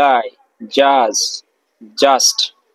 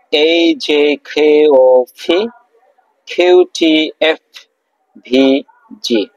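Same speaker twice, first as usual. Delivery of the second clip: each letter drawn out slowly and clearly; slow letter drill; much slower than usual. Shown as a demonstration. Teacher reciting the letters slowly to students, drawn out. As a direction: spell out the letters slowly for the class. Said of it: "আবার একটু repeat করি".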